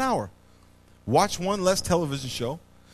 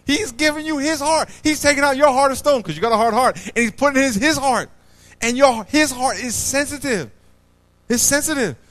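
loud, raised preaching; preaching at full force; 8 semitones higher, 5 dB louder than usual. Real, not acted. Preacher explains God's gift to the congregation, loudly preaching. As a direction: drive it home to the crowd.

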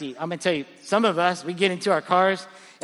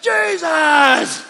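much higher, louder full shout of the name; finally shouting; 10 semitones higher, 8 dB louder than usual. Retold or imitated. Pastor finally shouting the answer at the gym, full shout. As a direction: give in and yell it out. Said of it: "So finally, I just gotta go".

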